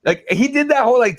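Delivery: ordinary talk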